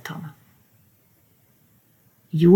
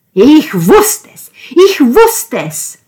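loud shout, building higher; geschrien; 8 semitones higher, 9 dB louder, slower than usual. A narrator's reading. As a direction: shout it twice, rising in fury. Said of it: "schrie er". (laut geschrien, immer höher)

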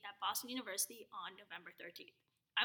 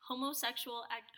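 usual speaking voice

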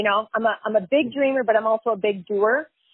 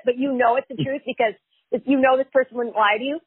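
usual speaking voice

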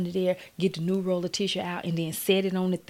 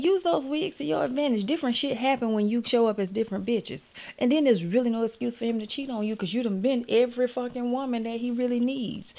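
normal talking voice

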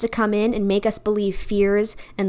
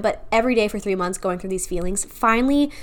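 ordinary talk

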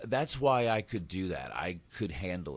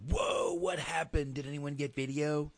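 imitating eight-year-old; 9 semitones higher than usual. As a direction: play an eager young son asking. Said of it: "my eight-year-old would be like".

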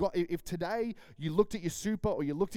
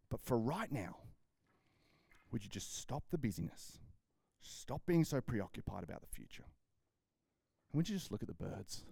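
gentle urging; 4 semitones lower, 6 dB quieter than usual. Retold or imitated. Jesus gently urging the listener.

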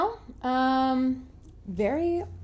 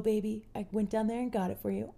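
ordinary talk, nothing marked

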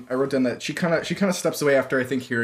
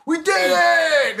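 high, slow mock cheer; over-the-top victory shout; mock triumph; 13 semitones higher, 4 dB louder, slower than usual. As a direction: cheer like you won, hammed up. Mock celebration of losing team.

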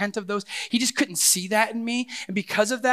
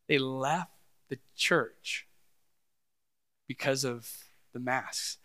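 slow, low and soft, with long pauses; slow disbelief; 7 semitones lower, 7 dB quieter, slower than usual. Real, not acted. Pastor telling why people left the church, slow and grave. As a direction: let each phrase drop and sink in. Pastor stunned, underlining the absurd reason.

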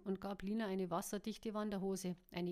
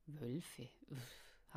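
soft, lowered, breathed out; 6 semitones lower, 8 dB quieter than usual. A narrator's reading. Caro reacts to news of wolves, breathed. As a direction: let it out on a faint breath. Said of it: "hauchte Caro".

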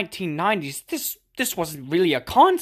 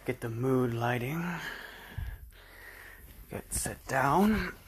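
whispering; 4 semitones lower, 7 dB quieter than usual. Acted. Soft opening, whispered start.

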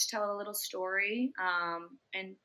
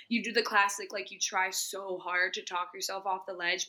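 ordinary talk, nothing marked

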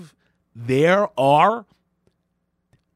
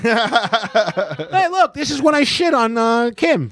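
very high, louder cartoon voice; mock Pete; 10 semitones higher, 4 dB louder, much slower than usual. Mocking Pete Davidson unveiling a book in a high voice. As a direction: present it like a goofy proud author. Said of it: "he's gonna be like".

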